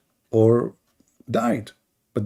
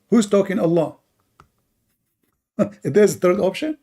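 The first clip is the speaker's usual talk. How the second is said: laughing mockery; 6 semitones higher than usual. Real, not acted.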